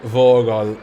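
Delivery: loud replay of a botched Cockney Dutch accent. Shown as a demonstration. Gamer puzzling over their own accent, loud Cockney Dutch. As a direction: repeat it loudly in muddled Cockney Dutch. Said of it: "It was like Cockney Dutch or whatever".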